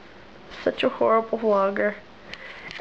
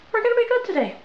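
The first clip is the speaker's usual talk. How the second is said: upbeat; 12 semitones higher, much faster than usual. Real, not acted. Upbeat start to cleaning day.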